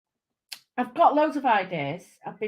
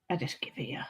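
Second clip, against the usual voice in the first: whispered aside; 7 semitones lower, 11 dB quieter, much faster than usual. Real, not acted. Quiet aside to viewers.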